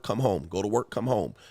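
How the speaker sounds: usual speaking voice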